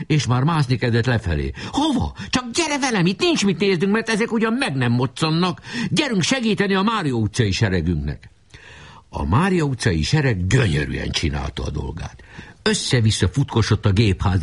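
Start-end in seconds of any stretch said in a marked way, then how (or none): 1.7-8.3 put-on high, hurried boy's voice; rally your comrade in a high voice; urgent boy. A narrator's reading. Boy urges comrade to help the troop, high and urgent. Voices boys urging each other on.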